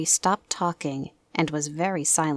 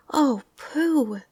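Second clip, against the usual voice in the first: delighted; 8 semitones higher, 5 dB louder than usual. Acted. Everybody admires.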